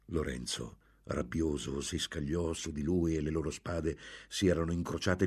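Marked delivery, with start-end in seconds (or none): none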